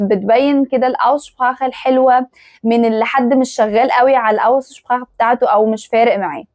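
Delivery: ordinary talk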